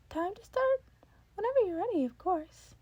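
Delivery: bright eager offer from a cheerful mentor; Nezu's voice. Acted. Nezu voiced by reader.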